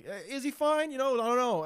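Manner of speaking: high, put-on unsure voice, lightly done; mock doubtful tell. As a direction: feign doubt in a sing-song way. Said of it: "I give him the old tell".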